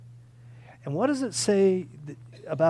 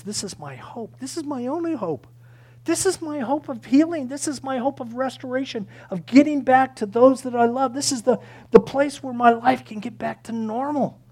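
higher, insistent building plea; hopeful plea; 7 semitones higher, 5 dB louder than usual. Acted. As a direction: voice the leper's mounting hope. Leper voiced pleading in hope.

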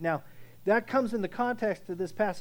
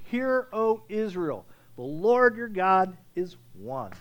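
slow, weighty reading of the Shema; emphatic declaration; 3 dB louder, slower than usual. Shown as a demonstration. Pastor reading the Shema slowly to the church. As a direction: lay each word down deliberately. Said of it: "He makes a very emphatic declaration".

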